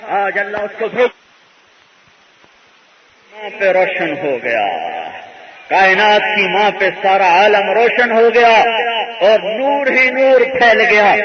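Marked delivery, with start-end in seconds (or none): 3.3-11.1 building to a loud, fervent proclamation; rising preacher's cry. Acted. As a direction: proclaim it louder as the light spreads. Preacher exults at the prophet's birth. (بلند جوشیلا اعلان)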